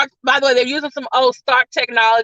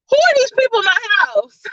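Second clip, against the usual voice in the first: indignant Happy; 8 semitones higher than usual. Retold or imitated. Happy watching intruders on doorbell cam.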